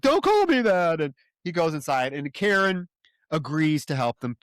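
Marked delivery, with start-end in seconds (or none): 0.0-1.0 whiny Alan. Retold or imitated. Alan answering a taunt.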